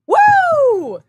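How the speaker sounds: high, loud woo; excited cheer. Real, not acted. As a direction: whoop in triumph. Celebrating a big sale.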